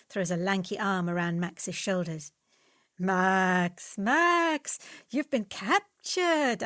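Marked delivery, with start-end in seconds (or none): 3.0-6.6 high, sing-song mock pity, slow; teasing. Fritz to Max, teasing him about his girlfriend. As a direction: tease him like a lost cause.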